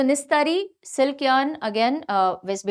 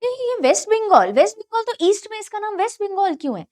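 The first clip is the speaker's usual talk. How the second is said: put-on high, loud girl's question; high-pitched mock girl asking; 9 semitones higher, 4 dB louder than usual. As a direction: imitate a puzzled girl, pitched way up. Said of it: "एक कोई girl थी, उसने पूछा".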